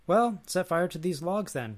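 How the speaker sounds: mildly acted shout, easing at the end; crying out. A narrator's reading. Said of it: "cried out Elijah".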